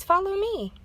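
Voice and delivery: high, loud follow me; eager call. A narrator's reading. Fox leading friends to the tree house, eager call. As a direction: beckon them on brightly.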